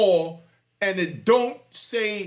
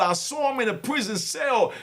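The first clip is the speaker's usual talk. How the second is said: ordinary talk, nothing marked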